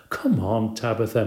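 ordinary delivery, nothing marked

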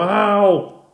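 high loud ow; boy yelping after touching something hot; sharp yelp of pain. A narrator's reading. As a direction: yelp from a burnt hand. Brian burned.

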